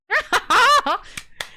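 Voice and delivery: high loud delighted laugh; burst of laughter. Real, not acted. Streamer laughing with chat after the find, loud happy laugh. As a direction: crack up with joy.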